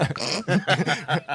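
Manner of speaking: loud, high laugh. Real, not acted. Laughs at his own outburst.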